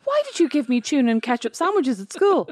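high, fast, indignant; accusing child. Retold or imitated. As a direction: demand an answer, quick and high. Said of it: "I went".

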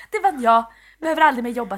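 loud, gleeful mock boast; mock triumph. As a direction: boast about never working again. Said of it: "Precis, bara".

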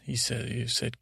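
plain reading voice

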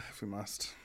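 low, hushed sigh of resignation; mock reluctance. Co-host jokingly agreeing to discuss the housewife, with mock reluctance. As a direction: sigh it very softly, feigning reluctance.

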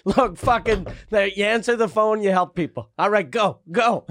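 mock boss barking orders. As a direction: bark it like a demanding manager.

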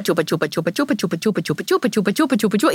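chupa, chupa, chupa with no break, quick and even; nonstop sucking demo. Shown as a demonstration. Showing a baby using the breast as a pacifier, nonstop chant. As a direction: run the chant on with no pause. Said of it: "está solamente chupa".

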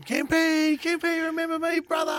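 imitating a bloke storming over; mock pub shout. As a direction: hail an old mate across the pub at full volume. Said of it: "come storming over the pub going".

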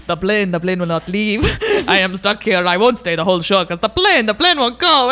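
a lightly voiced Slovakian man stuck at the airport; imitating Voss. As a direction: do the boyfriend's flat foreign delivery. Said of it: "he goes".

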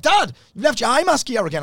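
high, lightly voiced kids calling out; kids nagging. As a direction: play the kids teasing dad. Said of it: "The kids are like".

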